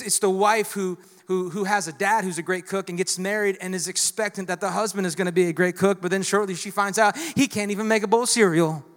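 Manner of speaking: normal talking voice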